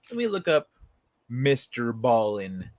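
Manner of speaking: ordinary delivery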